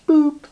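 very high short boop; comic boop. Acted. Preacher marks Pharaoh vanishing with a high boop. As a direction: pop it like a bubble vanishing. Judas gone.

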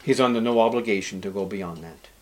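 normal talking voice

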